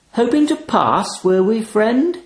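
high, smooth voice with a threat under it; a doorman sizing up a mark; menacing raven. A narrator's reading. Talking raven menacing the narrator, high and silky. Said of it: "Something about his tone, assurance laced with menace".

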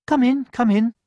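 high cheerful hen; a bright welcome; welcoming hen. A narrator's reading. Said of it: "said the hen with a wave".

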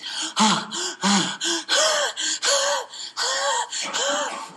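high, wheezy struggling breaths; gasping breaths. Shown as a demonstration. Patient in respiratory distress before the doctor, laboured gasping. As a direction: gasp noisily for air, drawn out.

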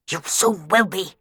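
hissed threat; menacing hiss. A narrator's reading. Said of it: "he hissed menacingly".

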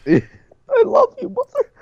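very high, slow falsetto; squeaky mock tender. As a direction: coo it in falsetto.

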